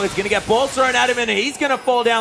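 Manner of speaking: loud, high hype call; excited shout. Real, not acted. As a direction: yell the teamfight like it's the final. Teamfight erupts in the base.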